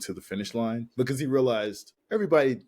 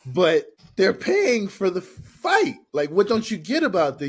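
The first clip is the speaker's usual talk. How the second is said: loud, high, exasperated mock arguing; heated retold argument; 9 semitones higher, 7 dB louder than usual. Jake arguing with his brother over sponsors, imitated exasperation. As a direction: push back hard, stretching the key words.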